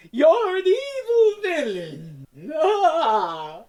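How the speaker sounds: high, slow, laughing villain cry; hammy evil villain. Pretend. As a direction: ham it up as a cackling villain. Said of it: "Go be a fucking ham".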